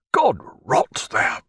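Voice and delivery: slow, breathy unvoiced curse, high; cursing under breath. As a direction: spit a curse in a rasping whisper, slow. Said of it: "lost in a deep growl of blasphemy".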